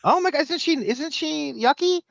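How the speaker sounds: high, incredulous mock of Kimmel. Host mocking the handshake in a high Kimmel voice. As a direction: squeal it up high like a disgusted germaphobe. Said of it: "Kimmel should be saying".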